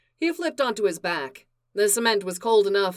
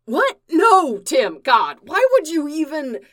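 high, loud, startled outburst; alarmed protest; 8 semitones higher, 7 dB louder than usual. Acted. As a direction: blurt it out in shock. Connor alarmed, protests loudly.

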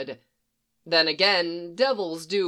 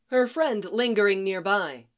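ordinary delivery, nothing marked

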